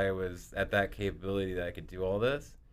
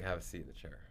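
quiet and grave; upset dad; 2 semitones lower, 13 dB quieter, much faster than usual. Retold or imitated. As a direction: softly order it like a troubled dad. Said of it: "He's very upset".

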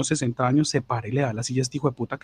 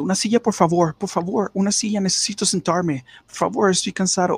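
whiny seat beggar; 7 semitones higher than usual. Retold or imitated. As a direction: beg for a seat in a raised whining voice. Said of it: "y diciendo".

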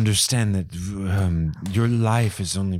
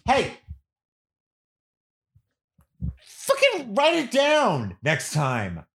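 yelling; 11 semitones higher, much slower than usual. Acted. Yelling at deaf aunt.

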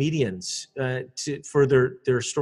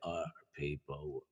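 much lower, slow creaky fry; vocal fry; 11 semitones lower, 18 dB quieter, slower than usual. Shown as a demonstration. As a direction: drop into a croaking vocal fry to show the fault. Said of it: "it's like a vocal fry".

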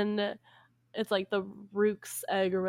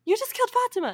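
high-pitched, louder mock shriek of shock; freaking out; 5 dB louder, much faster than usual. Princess accusing Aladdin of killing, mock freak-out. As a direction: gasp it out as a horrified princess. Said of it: "the princess freaks out".

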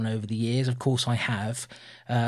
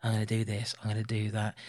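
quiet, low thinking aloud; inner monologue; 5 semitones lower, 10 dB quieter than usual. Retold or imitated. Co-host imitates Donna's inner thoughts, quiet inner monologue. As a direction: murmur it like private thoughts, imitated. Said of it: "there's a lot of inner monologuing".

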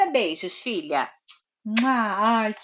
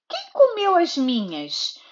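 a touch higher, lightly voiced mother bear; the mother bear asking, only lightly voiced; 5 semitones higher than usual. Mother reading to her daughter, hint of a mother bear. Mother bear's line, set against the baby's.

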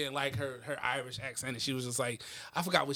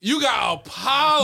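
excited; 6 semitones higher, 7 dB louder, slower than usual. Real, not acted. Mocking Apollo's fake accent.

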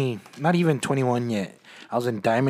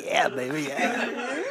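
mock lover voice; 10 semitones higher, much slower than usual. Mocking friend's reply.

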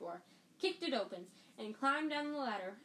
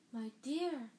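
breathed; 4 semitones higher, 4 dB louder than usual. A narrator's reading. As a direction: breathe it with foreboding. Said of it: "Professor Trelawney breathed".

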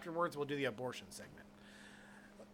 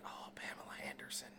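mock whisper; 11 dB quieter than usual. Mocking him staring at hand.

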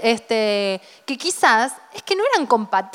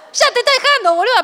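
bigger mock exclamation; 10 semitones higher than usual. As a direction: push it up high, exasperated. Mocking friend's fear, escalating.

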